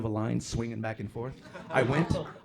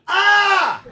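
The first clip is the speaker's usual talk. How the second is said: very high, loud scream of alarm; acted panicked yell; full-throated yell; 15 dB louder than usual. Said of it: "they yell at you like that".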